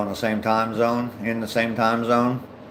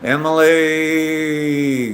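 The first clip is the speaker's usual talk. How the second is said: drawn-out name; 6 semitones higher, much slower than usual. Real, not acted. Streamer reads out a viewer's long name, drawn-out. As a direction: stretch the last sound of EMILY out very long, higher. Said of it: "that's a big, long name".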